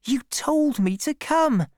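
put-on high, pleading girl's voice; hurt young woman, high-voiced; Fanny Robin. A narrator's reading. Fanny answers Troy, voiced.

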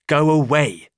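loud curt order; stern command. Acted. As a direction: bark him out of the room. Stranger orders Mr Hall out.

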